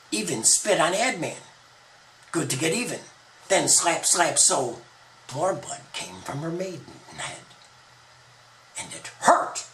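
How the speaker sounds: ordinary delivery, nothing marked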